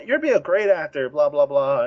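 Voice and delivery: ordinary talk, nothing marked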